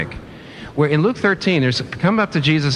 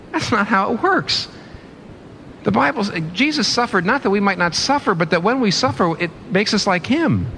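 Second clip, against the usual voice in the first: high-pitched, impassioned pleading; emotional preaching; 8 semitones higher than usual. Real, not acted. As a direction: plead it high, carried away by real emotion. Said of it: "I got emotional".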